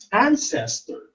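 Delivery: "ancestor" as AN-ces-tor; first-syllable stress. Shown as a demonstration. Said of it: "the first syllable is accented".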